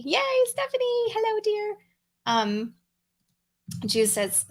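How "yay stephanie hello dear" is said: sugary high-pitched hello; excited greeting. Real, not acted. Greeting a viewer by name.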